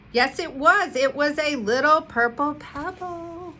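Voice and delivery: thrilled discovery. A narrator's reading. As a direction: savour the find, bursting with joy. Mouse finds the purple pebble.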